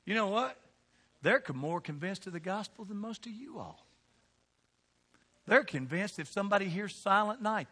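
ordinary talk

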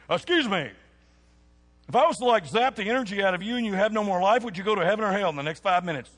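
loud, rushed mock evangelist; pushy church person. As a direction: imitate an overbearing door-knocker. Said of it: "church people say stuff like that".

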